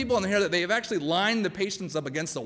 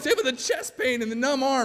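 indignant protest; 7 semitones higher than usual. Acted. Protests the EMT's diagnosis.

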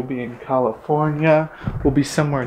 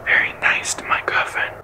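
whispering; 4 semitones lower than usual. Real, not acted. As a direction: whisper so you don't wake her. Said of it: "so that's why I'm whispering".

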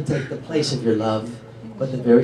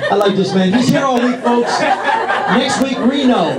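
showman quip; 7 semitones higher, slower than usual. Real, not acted. Comedian aside after laughs.

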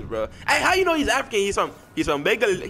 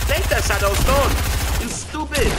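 pitched up and pushed louder; louder, higher repeat; 6 semitones higher, 4 dB louder than usual. Shown as a demonstration. Teammate demands stone, louder and higher.

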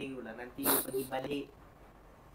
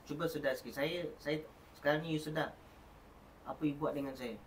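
usual speaking voice

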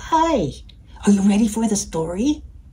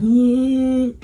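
short, higher yawning sound; mock yawn, voiced; demonstrated yawn; 4 semitones higher, 3 dB louder than usual. Shown as a demonstration. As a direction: show a little rabbit yawn. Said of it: "We just yawn".

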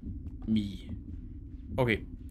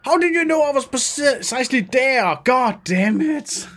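high, loud cry of disbelief; panicked shout; 11 semitones higher, 4 dB louder than usual. Real, not acted. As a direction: yell it out, rattled. Monster found the player.

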